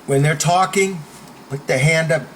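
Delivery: ordinary talk, nothing marked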